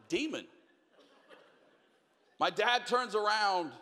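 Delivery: ordinary delivery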